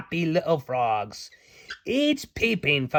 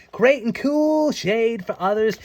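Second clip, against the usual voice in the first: ordinary delivery, nothing marked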